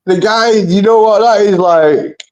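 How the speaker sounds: high, slow excited voice. Real, not acted. Friend getting excited about the gremlin story, high and slow. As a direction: get worked up and draw it out.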